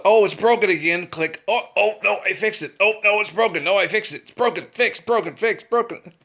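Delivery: high, loud silly back-and-forth; mocking the morons. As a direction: play the dimwit flipping a switch. Mocking crew flipping switch.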